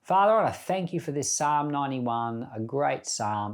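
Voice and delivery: ordinary delivery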